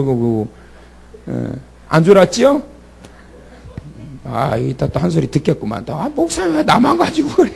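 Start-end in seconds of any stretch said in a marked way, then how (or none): 5.9-7.5 whiny protest. Retold or imitated. Deacon complaining to pastor.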